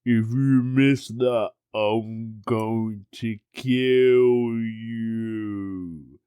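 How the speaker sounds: imitated threat dragged out in slow motion. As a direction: stretch every word like slowed-down playback. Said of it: "Everything slowed down, and all I could do was his voice going".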